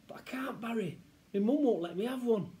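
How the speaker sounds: put-on high, whining kid; protesting boy. Child tells Barry mum won't allow it, protesting. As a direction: plead helplessly with a friend.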